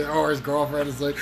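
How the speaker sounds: normal talking voice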